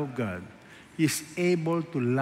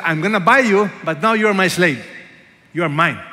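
loud, domineering claim of ownership; harsh master; 3 semitones higher, 10 dB louder than usual. Pretend. Hosea as a cold owner to Gomer, loud and harsh. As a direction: bark it like a man buying a slave. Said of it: "Hosea could have said".

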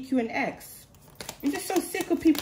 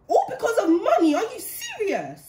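disbelieving laugh, rising higher, then slowing down; bitter laugh; 7 semitones higher than usual. Real, not acted.

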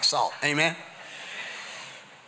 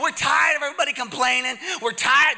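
higher-pitched mock grumbling; acted complaining; 10 semitones higher, 4 dB louder than usual. Tired disciples griping to Jesus about people, mock complaining. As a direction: complain like fed-up disciples, hammed up. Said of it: "they're complaining, they're tired".

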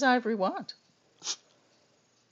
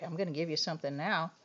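ordinary delivery

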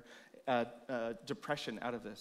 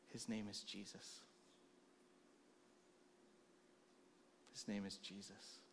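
soft lower whisper of the name; quiet reverent affirmation; hushed reverence; 6 semitones lower, 21 dB quieter than usual. Real, not acted. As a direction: let it fall to a breath. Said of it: "repeated hushed proclamation".

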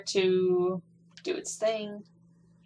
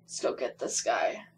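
whisper; 8 dB quieter than usual. Real, not acted. Muttering during gameplay.

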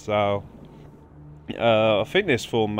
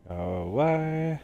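slow, higher, softer plea to leave; drawn-out shooing; 5 semitones higher, 4 dB quieter, slower than usual. Real, not acted. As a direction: stretch it out, climbing in pitch. Shooing a creature in the game.

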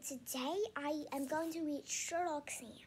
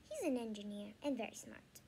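usual speaking voice

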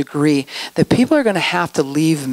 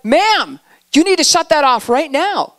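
urgent command; 9 semitones higher than usual. Retold or imitated. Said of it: "I'm like".

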